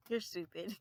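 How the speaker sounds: giggling through the insult; laughing taunt. Retold or imitated. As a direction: tease while laughing.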